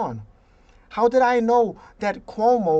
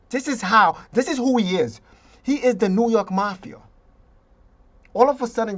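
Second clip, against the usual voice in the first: usual speaking voice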